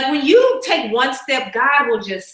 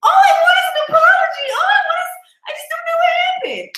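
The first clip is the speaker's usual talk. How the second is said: high, loud, hammed-up wail; sobbing at the TV over an apology; mock bawling; 4 dB louder than usual. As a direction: whine it out like a retold cry. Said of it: "Like, bawling".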